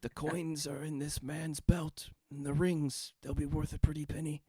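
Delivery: ghost voice. Acted. Ghost speaks through merchant's body.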